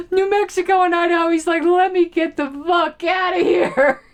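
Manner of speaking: high, loud voice full of laughter; laughing imitation. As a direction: squeal the mock quote while cracking up. Joke told through laughter.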